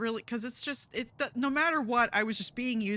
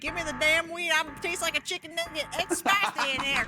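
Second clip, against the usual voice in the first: silly character voice; 7 semitones higher than usual. Pretend. Plays a chicken nugget.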